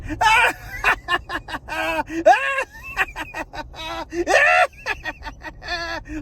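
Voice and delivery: high, long acted maniacal cackle; lunatic laugh. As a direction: cackle in repeated high bursts like a madman. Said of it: "Like a lunatic".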